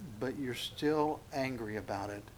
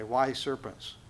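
usual speaking voice

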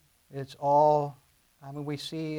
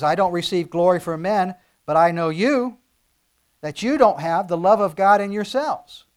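forceful rebuke; 3 semitones higher, 12 dB louder than usual. Acted. Preacher voicing Jesus' rebuke.

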